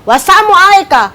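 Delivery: high, firm volley of curses; indignant retort. Acted. Aisyah answering the man who cursed the Prophet, high indignant retort. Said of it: "Orang ngomong satu, kita nyerepet".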